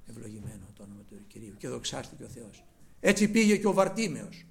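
ordinary talk, nothing marked

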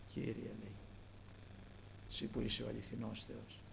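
low, soft prayer of Elijah; hushed prayer. Acted. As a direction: pray it quietly, with reverence.

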